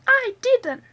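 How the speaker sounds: witchy cackle of a reply; cackling. A narrator's reading. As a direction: cackle it out gleefully. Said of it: "cackled the old woman".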